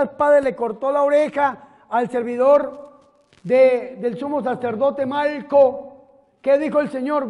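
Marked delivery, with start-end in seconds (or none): none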